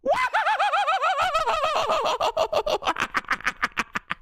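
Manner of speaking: very high, long held whoop breaking up as it fades; celebration scream. Real, not acted. Fan celebrating a goal, long screamed whoop. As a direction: let out one endless ecstatic shriek.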